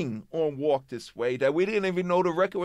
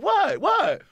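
high, loud yelped question; defensive protest; 12 semitones higher, 5 dB louder than usual. Retold or imitated. Rappers answering their waiting DJ, defensive yelp. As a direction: yelp it like a kid caught out.

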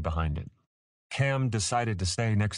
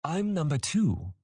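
put-on high-pitched childlike chirp; chirping youngster; 10 semitones higher than usual. A narrator's reading. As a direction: pipe up like an eager little one. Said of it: "Tori chirped".

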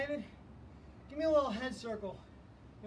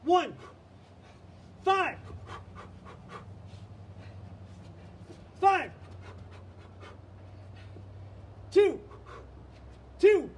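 shouted counts; 7 semitones higher, much slower than usual. Real, not acted. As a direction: call each count out hard. Coach shouting boxing counts.

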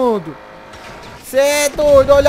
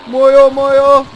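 louder, alarmed call; excited shout; 2 semitones higher, 6 dB louder than usual. Real, not acted. Gamer yelling during police chase, alarmed loud call. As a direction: shout that it's all gone wrong.

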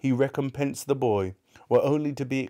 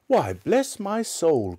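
slow, much higher, louder exclamation; startled cry; 8 semitones higher, 4 dB louder, slower than usual. Acted. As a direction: jump at the sight of him. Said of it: "cried Fred".

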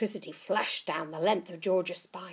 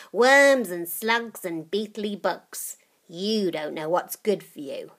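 put-on old witch voice; 8 dB louder than usual. Grandma voiced.

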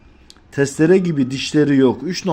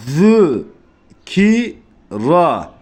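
Zü, ki, ra as separate drawn syllables, lisped Zü; letter by letter; 2 semitones higher, 3 dB louder, much slower than usual. Shown as a demonstration. Modelling slow reading for learners, letter by letter Zü, ki, ra. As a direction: pause fully between Zü, ki and ra. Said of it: "Şimdilik harf harf".

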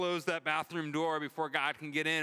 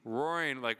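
ordinary talk

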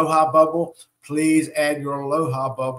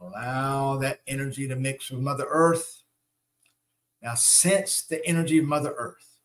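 guided meditation. Acted. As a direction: guide them through each breath, unhurried.

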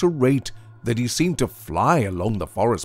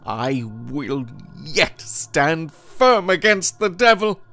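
defiant cry; 9 semitones higher, 4 dB louder than usual. A narrator's reading. Goodman Brown vows to stand against the devil, crying out. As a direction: cry it out high, building to a loud finish. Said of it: "cried Goodman Brown".

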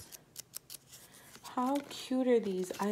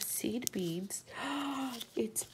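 hushed, breathy aside; whisper; 9 dB quieter, slower than usual. Real, not acted. Telling viewers a craft idea in a whisper. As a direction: share it like a secret.

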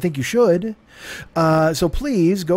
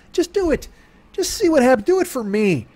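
excited; 8 semitones higher than usual. Acted. Urging listener excitedly.

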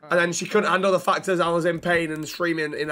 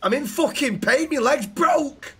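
much higher, slow, strained complaint; mock agony; 8 semitones higher, slower than usual. Injured patient telling his friend his leg is broken, hammed-up agony. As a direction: whine it out like your leg's snapped.

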